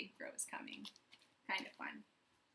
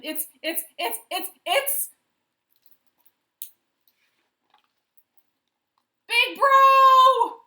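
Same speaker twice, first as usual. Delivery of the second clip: stuttering, rising higher and louder into a yell; stammer to a shout; 14 semitones higher, 21 dB louder, slower than usual. A narrator's reading. Elephant stammers then yells Big Bro is here. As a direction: stammer then scream the name.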